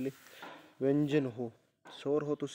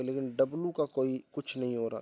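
ordinary talk, nothing marked

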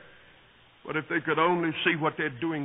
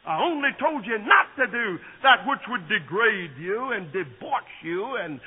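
louder, higher, slowing at the end; full-force preaching; 5 semitones higher, 4 dB louder than usual. Acted. Preacher warning the congregation, fervent.

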